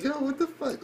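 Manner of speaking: high-pitched mock disbelief; incredulous reaction. Viewer reacting to the shady doctor, incredulous. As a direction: squeak out your disbelief.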